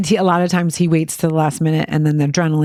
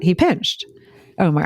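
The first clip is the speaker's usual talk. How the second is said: ordinary talk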